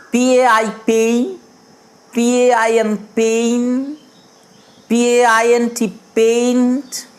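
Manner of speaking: পেইন্টেড built up slowly, letter by letter; building the word. Shown as a demonstration. Teacher builds up a word for the class, slowly. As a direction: add one letter at a time until পেইন্টেড. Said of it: "ভেঙে ভেঙে কিভাবে পড়ব".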